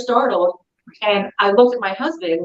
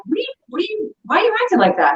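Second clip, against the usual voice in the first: worried husband; 12 semitones higher, faster than usual. Retold or imitated. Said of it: "he was like".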